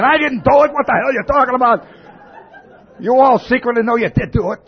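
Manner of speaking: cornered man shouting denials, then laughing at himself; defensive rant. Retold or imitated. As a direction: protest your innocence loudly, half laughing. Imagined guilty defensive rant.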